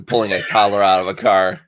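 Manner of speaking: usual speaking voice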